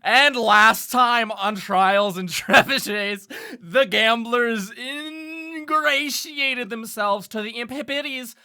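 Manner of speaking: slow, loud announcer recap; recap narration. Acted. DM recapping the story for listeners in a slow announcer voice. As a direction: read the "last time on" like a show trailer.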